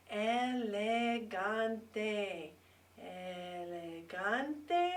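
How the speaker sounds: Elegante said very slowly so learners can hear elegant inside it; really slow. Shown as a demonstration. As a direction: drag out Elegante, letting each syllable hang. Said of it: "if I say it really slow, listen".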